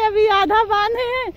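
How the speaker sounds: usual speaking voice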